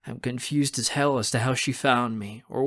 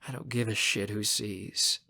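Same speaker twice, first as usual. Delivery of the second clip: plain reading voice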